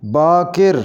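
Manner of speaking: बाकिर ending in 'kir'; correct pronunciation. Shown as a demonstration. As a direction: say बाकिर as 'baa-kir'. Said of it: "इसको सही पढ़ेंगे". (बाकिर के 'क' के नीचे ज़ेर बोलें)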